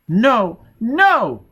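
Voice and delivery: put-on high, loud child's cry; frightened child. A narrator's reading. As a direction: squeal it like a scared little girl. Terrified child telepath refuses.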